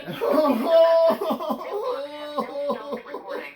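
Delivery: high, helpless laughter breaking the bit; long laughing fit. Real, not acted. Comedian collapsing into laughter mid-eulogy, real. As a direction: lose it completely, laughing.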